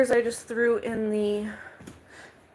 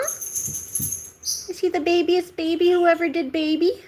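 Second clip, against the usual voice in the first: much higher, louder gushing; 8 semitones higher, 6 dB louder than usual. Real, not acted. Offering the cat food in high, louder baby talk.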